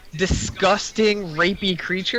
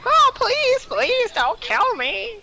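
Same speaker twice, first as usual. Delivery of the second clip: very high, slow begging goblin voice; squeaky goblin plea; much slower than usual. Shown as a demonstration. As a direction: whine it in a tiny creature squeak. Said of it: "Goblins are like".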